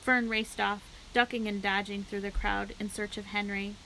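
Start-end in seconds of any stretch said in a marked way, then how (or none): none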